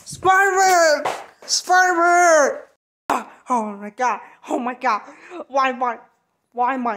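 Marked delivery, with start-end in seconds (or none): 0.1-2.6 shouting. Acted. Calling out for Spider-Man.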